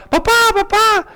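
high-pitched mock child's cry; child calling out. Child calls out as his papá leaves, mock pleading. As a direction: imitate a child calling dad back.

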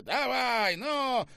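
high, impatient yell; shouted order. A narrator's reading. General shouts to the soldiers, impatient order. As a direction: urge them on sharply. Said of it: "крикнул генерал солдатам".

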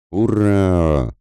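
high, drawn-out cheer; battle cry hurrah. Acted. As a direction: yell it as you charge. Soldiers shout during the charge. (протяжное «ура» в атаке)

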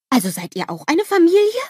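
high-pitched amazed asking; astonished question. A narrator's reading. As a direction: ask in surprise, voice shooting up. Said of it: "fragt Olaf erstaunt".

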